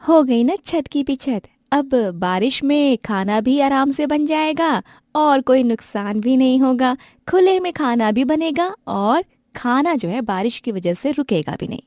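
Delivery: put-on bright, pleased young woman's voice; proud young wife; cheerful young wife. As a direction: show off the new rooftop kitchen. Character voice in a story.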